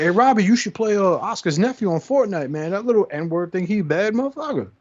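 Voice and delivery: high, hyped-up retold voice; imitated friend. Friend recommending the boy to his son, high excited imitation. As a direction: talk the kid up as an impressed dad.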